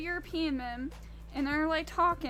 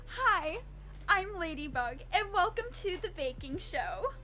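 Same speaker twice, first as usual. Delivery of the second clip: bright, higher host voice for a baking show; chirpy customer-service welcome, pitched up; 6 semitones higher than usual. Shown as a demonstration. Streamer demos her customer service voice for chat, chirpy and higher. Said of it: "my customer service voice".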